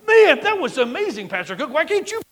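high, loud mock rave to the pastor; gushing listener. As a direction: play the starstruck congregant. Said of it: "You'd be like".

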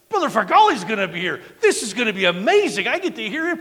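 loud, high and thrilled; excited gushing. Retold or imitated. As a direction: gush like a fan who can't wait. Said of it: "And then I'm like".

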